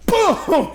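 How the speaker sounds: high, loud mock punch noise; cartoon punch sound; punch sound effect. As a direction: make the punch land with a high yelp.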